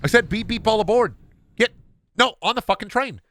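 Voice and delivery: high-pitched yelling conductor; angry conductor. Pretend. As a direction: scream at passengers from the cab. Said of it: "jump out of the conductor's seat".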